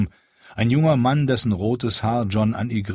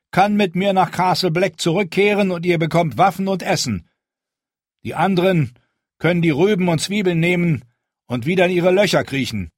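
commanding voice; 9 semitones higher, 5 dB louder than usual. A narrator's reading. Jon continues his address.

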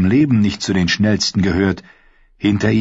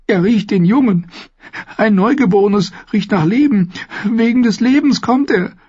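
put-on high, tearful young woman's voice; a frightened mother sobbing out the warning; 14 semitones higher than usual. Goldie sobs to Sam about her baby, tearful fear. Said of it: "schluchzte Goldie".

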